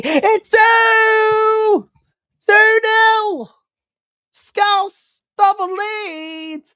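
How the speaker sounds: huge high shout of joy; goal celebration shout. Real, not acted. Commentator erupting as Liverpool score, ecstatic shout. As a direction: scream the goal call at full power.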